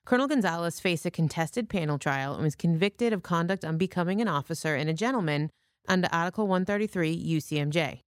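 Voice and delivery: ordinary talk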